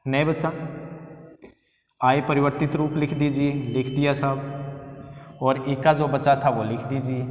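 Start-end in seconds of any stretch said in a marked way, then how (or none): 3.5-5.4 slow, soft drawl to himself; slow and quiet, half to himself; drawn-out aside. Real, not acted. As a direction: stretch it out lazily while writing. Teacher's drawn-out aside while writing.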